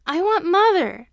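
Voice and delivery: high, loud, slow plea through tears; crying child. A narrator's reading. Crying boy begs teacher for his mother, wailing.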